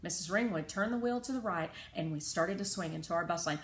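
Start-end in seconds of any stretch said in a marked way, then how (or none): none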